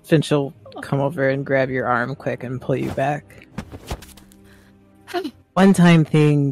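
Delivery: normal talking voice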